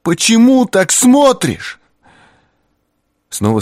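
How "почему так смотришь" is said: high, slow piercing scream; terrible scream. A narrator's reading. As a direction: scream the question in horror. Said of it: "неожиданно страшный крик".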